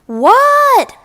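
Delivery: high, loud yelled question; loud shout. A narrator's reading. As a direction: yell back without thinking. Froggy answering a shout.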